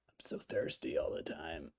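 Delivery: low, hushed, hammed-up longing; put-on breath-only confession; mock needy whisper. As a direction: whisper it as if desperately pining. Voices his thirsty self in a caption.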